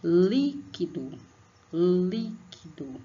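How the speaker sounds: "Líquido" drawn out slowly with "Lí" stressed; slow syllable stress. Shown as a demonstration. Teacher says "líquido" slowly so pupils hear "lí". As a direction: stretch "líquido" slowly, leaning on "lí".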